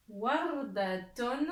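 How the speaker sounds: very slow model word; lecture appuyée. Shown as a demonstration. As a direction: draw out each syllable of Wardatun. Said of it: "Je vais lire les mots". (lecture lente et appuyée)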